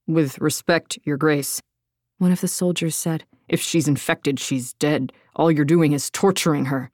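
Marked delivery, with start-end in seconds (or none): 0.1-1.6 low, measured polite objection; a lower, grave, deferential soldier voice; wary soldier. A narrator's reading. Soldier addressing Darina. 3.5-6.9 low, blunt soldier voice; grim soldier. A narrator's reading. Soldier argues Lydia is doomed, low and grim.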